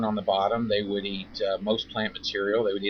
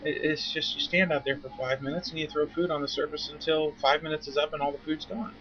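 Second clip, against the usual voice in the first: much higher, insistent no-no-no; emphatic correction; 6 semitones higher than usual. Retold or imitated. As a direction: brush aside the worry and set them straight. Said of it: "You say".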